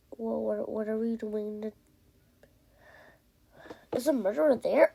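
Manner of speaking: ordinary delivery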